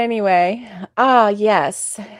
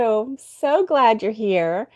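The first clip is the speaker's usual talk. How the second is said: normal talking voice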